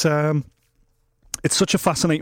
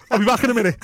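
gleeful excuse; 8 semitones higher, 3 dB louder, much faster than usual. Retold or imitated. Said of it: "You go".

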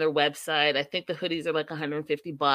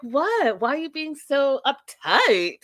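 playful mock innocence; 7 semitones higher, 4 dB louder, slower than usual. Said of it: "And she's like".

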